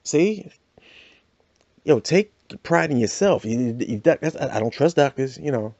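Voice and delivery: normal talking voice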